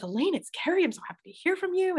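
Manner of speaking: retold delight at hearing from her; excited greeting. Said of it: "and I was like".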